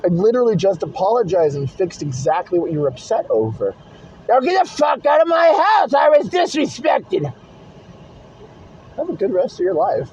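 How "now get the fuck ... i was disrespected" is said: imitating a furious ex-friend yelling; mock angry yell. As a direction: parody a friend throwing you out. Mocking an angry former friend.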